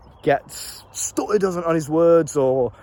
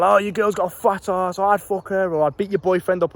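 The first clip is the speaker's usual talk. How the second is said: higher-pitched mock yell of a lad; retold taunting shout; 4 semitones higher than usual. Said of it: "shouting out".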